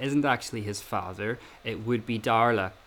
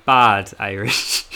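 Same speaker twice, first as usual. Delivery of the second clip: laughing; 9 dB louder, much slower than usual. Real, not acted. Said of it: "speaker laughing while saying it".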